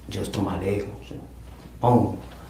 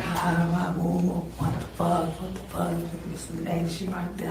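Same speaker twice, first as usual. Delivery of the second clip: high, pitched-up mock screaming; imitating Selena's screams; 9 semitones higher than usual. Retelling Selena screaming over the nurse, high and frightened.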